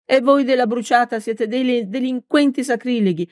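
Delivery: high-pitched, vehement comeback; fervent accusation in a narrator's high girl voice; heated retort. A narrator's reading. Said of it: "ribatté con foga la ragazza".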